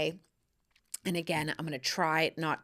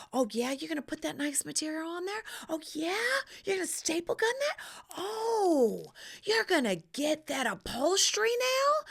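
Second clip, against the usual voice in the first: much higher and faster, building to a scream; mounting excitement; 9 semitones higher, 4 dB louder than usual. Pretend. She eggs on the upholsterer, building to a squealing climax. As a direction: cheer the chair on like it's porn, climaxing. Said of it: "I'm like".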